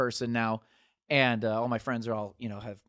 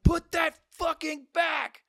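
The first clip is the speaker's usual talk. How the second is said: high, strained mock scream, words drawn out; imitated yelling; much slower than usual. Skate shop crowd warning a visitor off the old drink, mock yelling. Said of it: "They were yelling and screaming at me".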